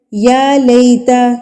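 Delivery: یا لیتا with the یا drawn out one count; stretched vowel. Shown as a demonstration. Teacher shows the stretched یا in یا لیتا. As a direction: draw out the یا of یا لیتا slowly. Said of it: "ایک حرکت کے برابر کھینچ".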